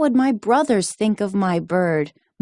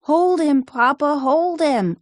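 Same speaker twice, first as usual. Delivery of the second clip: frightened cry; 9 semitones higher than usual. A narrator's reading. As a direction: yell for help from the sand. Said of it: "I cried".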